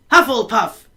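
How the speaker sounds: loud, high house call; Hat shouting. A narrator's reading. Sorting Hat announces the house, loud. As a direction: call it out to the hall. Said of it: "shouted the Hat again".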